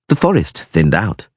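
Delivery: ordinary delivery, nothing marked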